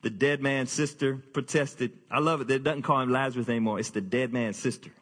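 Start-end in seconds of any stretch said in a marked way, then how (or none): none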